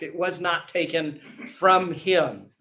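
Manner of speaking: ordinary talk, nothing marked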